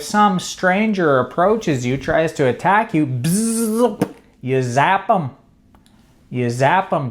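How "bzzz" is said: higher buzzing zap noise; imitated taser buzz. Phone owner tasing an attacker, imitated electric buzz. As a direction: buzz like a little taser going off.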